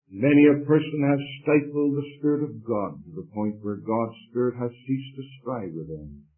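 ordinary talk, nothing marked

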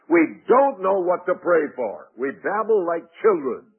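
high, loud pulpit declaration; forceful preaching. Acted. Preaches the contrast loudly.

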